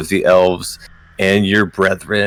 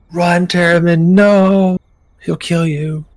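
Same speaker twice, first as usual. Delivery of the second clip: high, drawn-out mock scream of warning; acted scream; 11 semitones higher, 6 dB louder, slower than usual. Game master voices the beloved screaming a warning to Terramin, high drawn-out scream. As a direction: cry out a long, high warning, held back from full scream. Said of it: "you hear her voice screaming".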